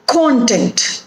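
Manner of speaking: Content said as CON-tent; stress on first syllable. Shown as a demonstration. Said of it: "the stress is on the first syllable".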